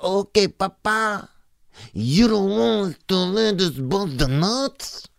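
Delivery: put-on high, slow Chicano accent caricature; mock vato accent. Comic imitating vatos turned down, mock vato accent. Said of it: "Vatos would be like".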